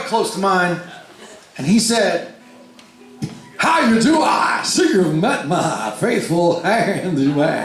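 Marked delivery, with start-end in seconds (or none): 3.6-7.6 hammed-up showman welcoming a guest; grand flamboyant host. Tall man in lingerie greeting the nervous teen at his booth, grand theatrical voice. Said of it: "that's not what he said, but that's how I like to remember it".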